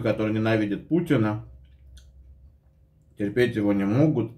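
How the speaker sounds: ordinary talk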